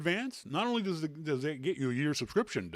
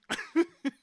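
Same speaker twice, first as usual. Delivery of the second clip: high laugh. Real, not acted. Host laughs after retold insult.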